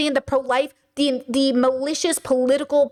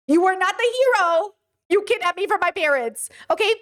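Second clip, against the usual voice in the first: higher, heated and accusing; indignant outburst; 6 semitones higher than usual. Acted. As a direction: throw it back at her like the wronged child. Confronting the kidnapper, imagined as the child.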